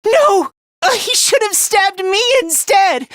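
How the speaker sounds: high, anguished cry of self-blame; distraught outburst. Acted. Fighter wishing he was stabbed instead, distraught.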